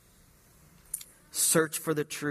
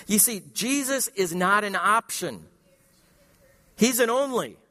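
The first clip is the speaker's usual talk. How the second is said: rising, louder preaching build; 5 semitones higher, 3 dB louder than usual. Acted. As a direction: drive it home like a pulpit climax. Preaching at full force.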